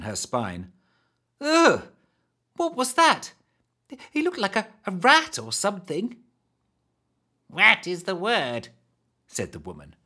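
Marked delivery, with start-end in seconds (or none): 1.4-6.2 put-on high disgusted girl. Disgusted girl after he leaves. 7.5-8.7 high slow hag. Acted. Hag agrees.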